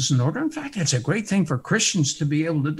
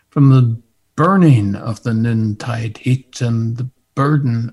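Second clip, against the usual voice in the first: hymn recitation; 6 dB louder than usual. Acted.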